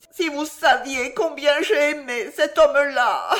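high, loud theatrical wail; hysterical lament. A narrator's reading. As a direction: pour out wounded love to the maid. Hysterical wife laments her love. (lamentation aiguë et théâtrale)

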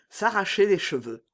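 usual speaking voice

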